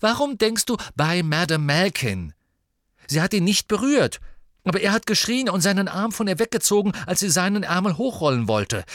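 loud, high, urgent argument; agitated, pushing to be believed; heated insistence. A narrator's reading. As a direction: press the case forcefully, high and loud. Acted heated argument by Harry.

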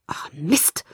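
hushed curse under breath; whispered curse. A narrator's reading. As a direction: swear it in a whisper. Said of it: "fluchte ich".

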